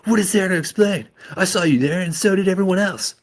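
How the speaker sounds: high, loud snapping back; angry retort. Acted. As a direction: shoot it back furiously. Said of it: "I shot back".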